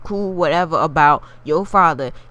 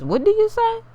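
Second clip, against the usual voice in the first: mock shocked question; 3 dB quieter than usual. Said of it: "she stops and she says".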